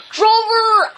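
dog shouting to his partner; yelling over the wind. Acted. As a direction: call out over a storm. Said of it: "I yelled over the wind and rain".

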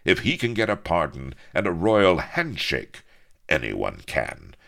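loud, ringing boast; bold cry. A narrator's reading. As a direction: clinch the argument. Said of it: "cried Avery".

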